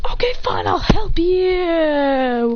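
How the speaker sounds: high start, long drawn-out ending; exasperated giving in. Acted. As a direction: give in with a stretched mock wail. Acted reluctant giving in.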